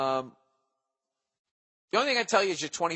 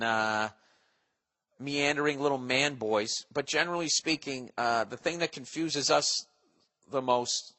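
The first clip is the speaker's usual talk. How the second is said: ordinary talk, nothing marked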